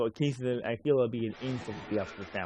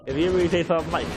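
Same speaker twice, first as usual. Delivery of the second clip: normal talking voice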